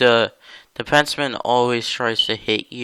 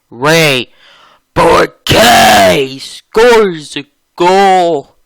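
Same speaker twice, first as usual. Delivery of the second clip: high, loud, slow goal call; excited goal call; 6 semitones higher, 10 dB louder, slower than usual. Real, not acted. Calling a goal in game.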